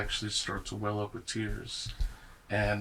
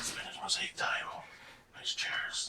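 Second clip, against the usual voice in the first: soft unvoiced whisper listing details; hushed whisper of scene details; 9 dB quieter than usual. Real, not acted. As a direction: murmur ideas under your breath.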